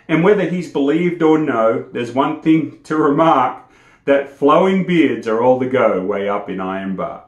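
lively bush ballad recital, rising into high loud drama; poem recitation. Acted. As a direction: recite it like a campfire yarn, building to the fight.

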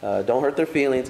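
low, offhand mock-casual Paul; a lightly voiced, too-relaxed apostle. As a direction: shrug it off as if it hardly matters. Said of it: "He didn't talk like that".

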